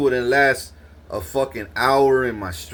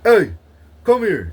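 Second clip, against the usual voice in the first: mock cop call; 8 semitones higher, 7 dB louder than usual. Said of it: "a lady cop comes up".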